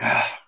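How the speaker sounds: breathy exhaled "ah"; deep breath. Retold or imitated. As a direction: imitate the doctor breathing out deeply. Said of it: "he took a deep breath".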